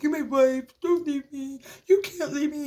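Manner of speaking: high whiny pleading husband voice; mocking crying husband. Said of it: "this man was before me crying".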